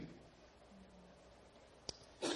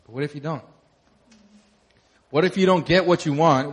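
ordinary delivery

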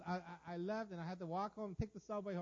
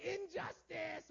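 high, long-drawn cry of protest; hammed-up wronged wail; mock outrage; 11 semitones higher, much slower than usual. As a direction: stretch it out in high indignation. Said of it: "I was like".

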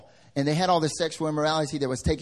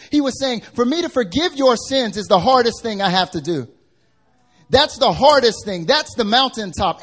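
loud, high, driving sermon voice; full-force preaching; 9 semitones higher, 9 dB louder than usual. Acted. Pastor preaching hard to the congregation, loud and high. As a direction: preach it out, hammering each line.

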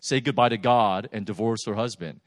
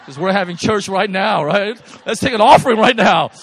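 much higher, very loud laughing cry; joyful shout; 8 semitones higher, 16 dB louder than usual. Real, not acted. Pastor jokes to congregation about taking an offering, loud laughing shout. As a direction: shout it like a revival peak.

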